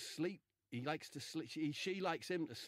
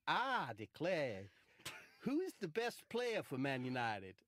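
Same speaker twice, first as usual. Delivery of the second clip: southern US drawl; 2 semitones higher, 4 dB louder, slower than usual. Retold or imitated. Marcus voiced again.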